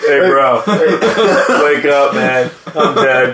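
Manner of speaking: low lazy stoner drawl. Pretend. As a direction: slur it like a stoned dude. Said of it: "like, a stoner voice".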